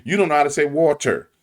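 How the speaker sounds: ordinary talk, nothing marked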